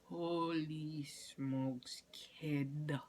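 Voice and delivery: very slow, stretched-out words; stunned drawl. Retold or imitated. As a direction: drag out every word in mock dismay. Her stunned reaction to scolding.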